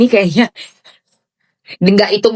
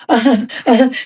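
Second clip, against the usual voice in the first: laugh. Real, not acted.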